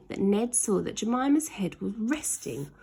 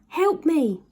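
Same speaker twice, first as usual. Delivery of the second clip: higher, louder desperate cry; shout for help; 8 semitones higher, 6 dB louder than usual. A narrator's reading. Ned falling and begging Slime for help, shouting. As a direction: yell for rescue while falling. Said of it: "he shouted up".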